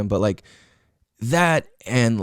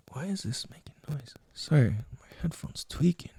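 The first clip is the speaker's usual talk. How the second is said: whispered aside, much softer, no voice; 10 dB quieter than usual. Real, not acted. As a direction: drop to a whisper over the headphone noise. Aside about noisy headphones.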